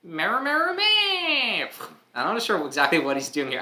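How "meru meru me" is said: very high, loud horse squeal; creature cry. Acted. Horse creature calls to its friends, high and loud. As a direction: squeal it out eagerly.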